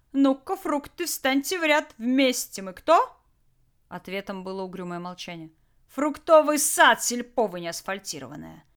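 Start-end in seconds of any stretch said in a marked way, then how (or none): 0.1-3.2 higher, loud, teasing commands; gleeful taunting. Acted. Jack amusing himself with captured guards, gleefully bossy. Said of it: "Джек заметно развеселился". 5.9-8.6 loud, exaggerated scolding; mock reproach. Jack answering his own riddle to silent guards, reproachfully. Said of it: "укоризной просветил Джек".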